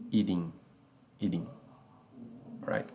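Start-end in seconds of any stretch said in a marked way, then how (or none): 0.1-1.5 "Eating" said ee-ding, the T flapped into a D. Shown as a demonstration. Teacher repeating "Eating" with the T turned into D. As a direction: say "Eating" with a D, like D de dia. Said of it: "Então fica assim".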